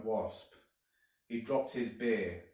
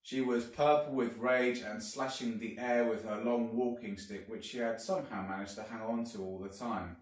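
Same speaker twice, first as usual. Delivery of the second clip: ordinary delivery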